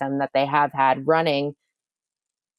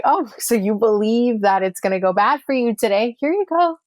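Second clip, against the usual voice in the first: higher, louder sly voice of the universe, climbing to a high squeak; the universe answering; 6 semitones higher, 4 dB louder than usual. Acted. The universe answering a gloomy person, sly and much higher. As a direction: play the universe cheerfully granting the bad day. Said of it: "And then the universe is like".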